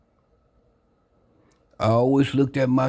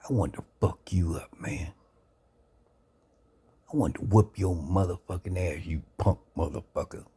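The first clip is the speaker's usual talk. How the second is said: mock-threaten him under your breath; angry threat; 3 dB quieter than usual. Pissed-off visitor threatening the bedridden speaker, hushed menace. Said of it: "pretty pissed off at me and said".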